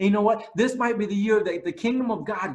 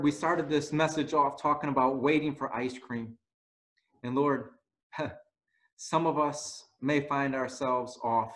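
closing prayer; 9 semitones lower than usual. Acted.

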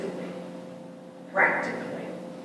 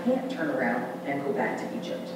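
quiet inner resolve; 3 semitones lower, 8 dB quieter than usual. Retold or imitated. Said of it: "and you say to yourself".

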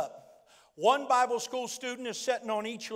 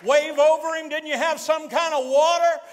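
high, shouted revival sermon; full-force preaching; 7 semitones higher, 11 dB louder than usual. Real, not acted. Preacher urges congregation to get the revelation, impassioned shouted preaching.

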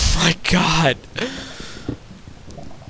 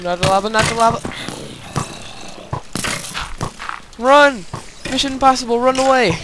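being chased; 4 semitones higher, faster than usual. Real, not acted. Creeper chasing, running away.

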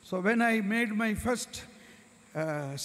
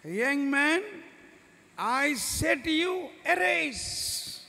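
commanding call; 6 semitones higher, much slower than usual. Acted. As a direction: raise the dead with a ringing order. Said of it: "And I said".

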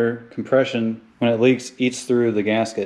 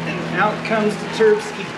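much higher, playful announcing voice; silly high voice; 9 semitones higher than usual. Pretend. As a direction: pipe it up like a cheerful cartoon. Playful turbo nickname.

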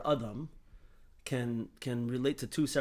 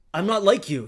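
higher and louder, insistent; emphatic exclamation; 8 semitones higher, 11 dB louder than usual. Retold or imitated. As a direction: press the point hard, exclaiming. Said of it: "he says".

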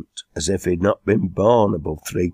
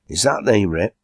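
usual speaking voice

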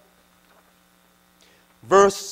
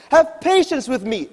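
pleading; 7 semitones higher, 4 dB louder than usual. Acted. Fellow slave pleading on ground.

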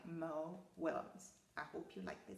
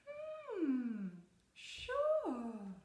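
naive duck; 9 semitones higher, 5 dB louder than usual. Acted. Duck answers fox.

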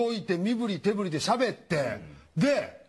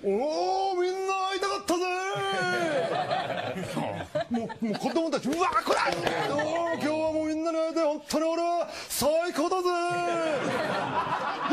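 high, slow, laughing surfer-dude turtle; hammed-up cheery turtle voice; Crush the turtle; 6 semitones higher, slower than usual. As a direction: greet the kids like a cool turtle. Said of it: "亀がブワー来て".

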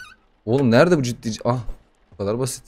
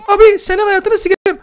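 put-on much higher, louder cheeky baby threat; a tiny brat swearing; squeaky baby voice; 5 dB louder than usual. Gamer voices the demon baby cursing him, squeaky.

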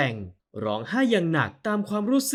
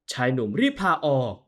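ordinary delivery, nothing marked

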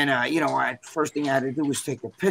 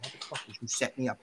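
very soft, under the voice; inner thought; 3 semitones lower, 11 dB quieter than usual. Retold or imitated. Contestant realizing the producers set him up, muttered thought. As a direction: say it to yourself, barely audible. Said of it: "I'm thinking in my head".

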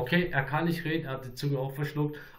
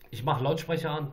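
ordinary talk

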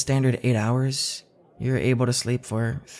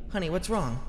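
higher, softer, worried honey, what's wrong; a soft, anxious wife's plea; 7 semitones higher, 5 dB quieter than usual. Acted. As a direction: ask honey, what's wrong in hushed alarm. Wife sees husband panicking.

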